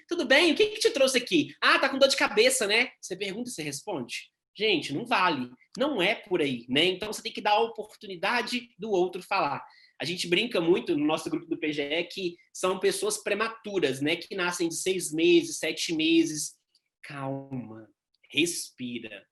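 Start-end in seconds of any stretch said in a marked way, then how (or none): 0.1-2.9 higher, louder, rushing to answer for the patient; a proactive nurse firing questions and answering them; overeager nurse. Retold or imitated. Nurse to patient, eager and loud, answering his own question. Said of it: "que vira e fala assim". 17.0-19.3 much lower and softer, slowing down; calming himself. Real, not acted. Teacher to himself, mid-lecture, calming down softly. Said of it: "Eu falo muito rápido".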